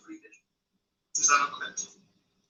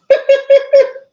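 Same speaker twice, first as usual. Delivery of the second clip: loud laugh; 11 dB louder than usual. Real, not acted. Loud laugh after curse.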